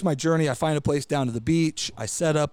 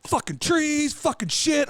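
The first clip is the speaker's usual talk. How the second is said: mock angry cussing; 12 semitones higher, slower than usual. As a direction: re-enact cursing to yourself in frustration. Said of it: "I'm mad. I'm like".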